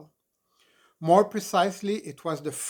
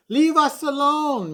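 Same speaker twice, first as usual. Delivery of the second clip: loud, much higher yell; hollering; 11 semitones higher, 8 dB louder than usual. Acted. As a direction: holler it as you fall back. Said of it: "Mimi hollered".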